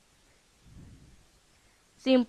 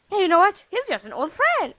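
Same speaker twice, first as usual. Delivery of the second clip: mock breezy excuse; 7 semitones higher, 3 dB louder, faster than usual. Mocking Cadance's excuse.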